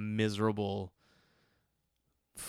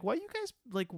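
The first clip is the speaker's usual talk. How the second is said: incredulous; 13 semitones higher, 4 dB quieter than usual. Retold or imitated. Imagined complaint to his friends.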